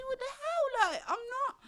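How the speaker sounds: high, incredulous protest; mock-shocked what the hell; outraged disbelief. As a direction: refuse to believe it, voice jumping up.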